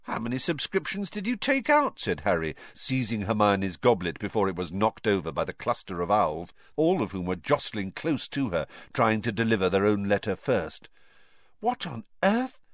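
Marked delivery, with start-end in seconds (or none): 0.0-2.0 lightly voiced schoolboy, raised pitch; a hint of a curious boy; teen boy voice. A narrator's reading. Boy character quote. 11.6-12.6 put-on high puzzled girl voice; a touch of bewildered schoolgirl; surprised. A narrator's reading. Girl character quote.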